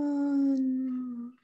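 soft, drawn-out yawn; long yawn. A narrator's reading. Sleepy someone just waking in cave, yawning. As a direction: yawn slowly, trailing away.

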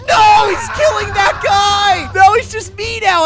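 distressed shout; dismayed cry. Real, not acted. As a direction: wail as an ally falls. Last ally being killed.

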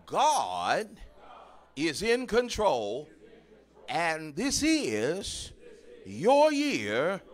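loud, rising preacher's call; preaching at full force. Acted. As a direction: build it up and lift each phrase. Preaching crescendo to congregation.

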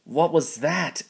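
high, loud frightened cry; fearful shout. A narrator's reading. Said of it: "Emily shouted fearfully".

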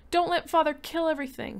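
high, loud begging wail; boy begging the house to save the nursery; wailing plea. Acted. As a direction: plead like your world is ending. Boy pleading loudly with house.

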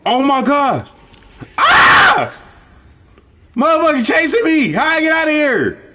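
frantic screams while running away; being chased. Real, not acted. Chased by killer in game.

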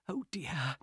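breathless whispered gasp; a horrified aside; shocked whisper. Acted. Harris panicking to guard.

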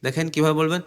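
normal talking voice

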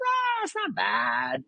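high, soft, drawn-out dismissal; underplaying it. Upset father to his son, imitated as underplaying, high and soft. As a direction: imitate Dad playing it down, high and slow. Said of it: "he was underplaying what was going on".

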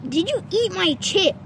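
ordinary talk

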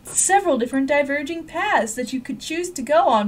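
normal talking voice

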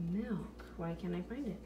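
usual speaking voice

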